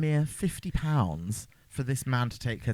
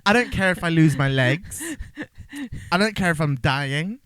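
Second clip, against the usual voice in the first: hammed-up pleading, higher and louder; imagined desperate man; 5 semitones higher, 6 dB louder than usual. Injured man begging his rescuers, mock-desperate. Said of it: "some men might be like".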